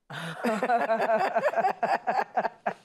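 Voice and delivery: a burst of real laughter. Real, not acted. Laughing at the elders' question about the dream, real laugh.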